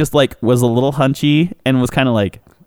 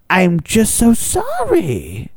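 slow apology; 5 semitones higher, much slower than usual. Retold or imitated. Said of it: "She goes".

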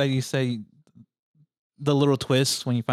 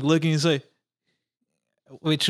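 ordinary talk

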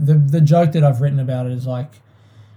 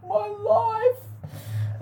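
exasperated wail; 11 dB quieter than usual. Real, not acted. Dismayed at how long ago it was.

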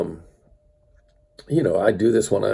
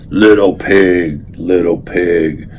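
lower, slow, louder wolf voice; wolf calling the first little pig, lower and slow; big bad wolf; 4 semitones lower, 4 dB louder, slower than usual. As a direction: growl it slowly at the pig's door. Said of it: "he roared".

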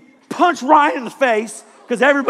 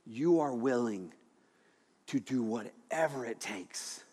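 much softer, slow, intimate preaching; soft reflective sermon; 8 semitones lower, 11 dB quieter than usual. Acted. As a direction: pull the room in close and quiet.

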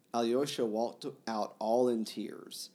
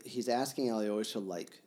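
ordinary delivery

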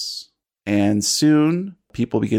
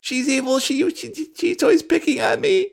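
put-on high childish insistence; a little girl insisting on her side of the story; whiny little girl. Gertie argues with Rosa in front of her mom, high whiny child.

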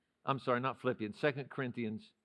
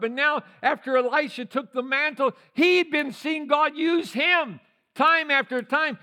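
higher, louder rising preacher's cry; fervent preaching climax; preaching at full force; 9 semitones higher, 8 dB louder than usual. Acted. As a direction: build the sermon to a roar.

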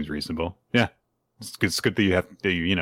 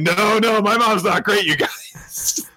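put-on much higher, childlike panicked cry; panicked Anya; 11 semitones higher, slower than usual. Anya protesting to classmates, high panicked child voice. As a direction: squeal it like a flustered little girl. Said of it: "And she's like".

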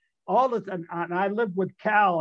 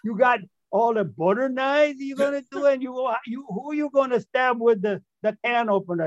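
El Turco; 6 semitones higher than usual. Retold or imitated. Prankster posing as robber to his friends, high put-on voice. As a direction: play the masked robber demanding the money. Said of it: "I say".

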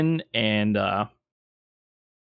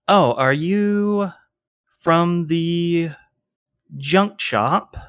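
condescending; 6 semitones higher, much slower than usual. Acted. GM voices secretary, condescending.

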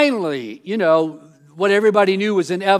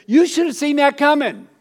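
higher, louder told-you-so to a friend; 8 semitones higher, 6 dB louder than usual. Retold or imitated. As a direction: wag a finger at a friend who ignored the signs. Telling a friend off.

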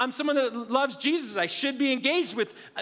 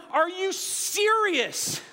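drawn-out, higher-pitched disbelief; incredulous; 6 semitones higher, much slower than usual. Retold or imitated. Appalled passenger reacting to gross seatmate, incredulous. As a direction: stretch it out in mock outrage.

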